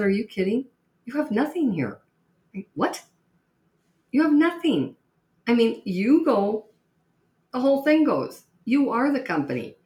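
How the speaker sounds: mock John's astonished outburst; incredulous. Consultant John reacting to selling the firm, high incredulous outburst.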